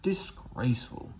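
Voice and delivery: hissed under the breath, falling; grumbling engine hissing to himself. Acted. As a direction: spit the word out in disgust. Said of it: "he hissed".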